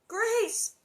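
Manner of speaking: high, loud call; shrill call. A narrator's reading. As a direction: call out over the wind. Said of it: "Ma's shrill call".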